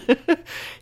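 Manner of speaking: a short high laugh through tears; real little laugh, half crying; teary laugh. Real, not acted. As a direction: laugh it off while choked up. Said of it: "I'm all teary-eyed again".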